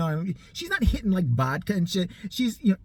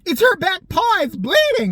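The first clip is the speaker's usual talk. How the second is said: higher, louder retold alarm; urgent exclamation; 10 semitones higher, 6 dB louder than usual. Friend spots the injury while searching, urgent exclamation. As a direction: call out the discovery urgently.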